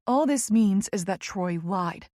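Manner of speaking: usual speaking voice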